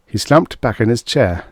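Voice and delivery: plain reading voice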